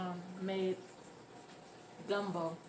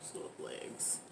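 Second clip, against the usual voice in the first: whisper; 14 dB quieter than usual. Real, not acted. Soft aside while eating.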